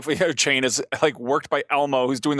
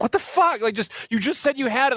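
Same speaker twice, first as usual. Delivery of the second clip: high, incredulous mock outburst; exasperated high; 12 semitones higher than usual. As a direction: squeak it in outrage. Retold outrage at counter.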